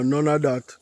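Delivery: ordinary talk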